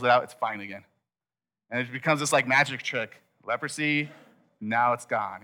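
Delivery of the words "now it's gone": usual speaking voice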